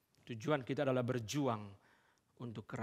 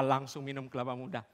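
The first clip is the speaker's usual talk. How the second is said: ordinary talk, nothing marked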